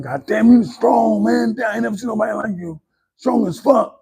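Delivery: high-pitched mock yell without the volume; retold scream. Sparring partner amazed at his strength, high mock scream. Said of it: "He would just scream to me like".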